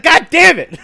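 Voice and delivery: loud, high, laughing exasperated curse; mock exasperation. Fed up with the coworker's answer, laughing exasperated curse. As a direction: blurt it out, half laughing. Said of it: "just like".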